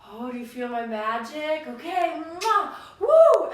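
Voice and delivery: building to a very loud, high whoop with a kiss sound; playful magic cheer. Retold or imitated. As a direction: hype the kid up with pretend magic. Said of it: "And I'm always like".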